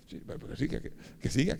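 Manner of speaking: hushed, impatient prodding; impatient aside. Retold or imitated. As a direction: urge him on under your breath. Impatient inner aside while waiting.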